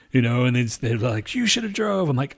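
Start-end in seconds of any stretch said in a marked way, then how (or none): 1.3-2.1 high-pitched mocking accusation; mock accusing whine; mimicked blame. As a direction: parrot the sister's complaint in a higher voice. Mimics sister's blaming complaint.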